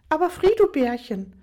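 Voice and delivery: high, pleading pet-name whine; wheedling high-pitched protest; whiny mistress. Acted. Tiffany protests being sent away.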